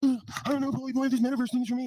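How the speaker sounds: high, put-on whiny voice of a scared guy; whiny excuse voice. Mocking a guy who went missing, high whiny voice. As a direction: whine the excuse like a coward. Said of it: "coming back like".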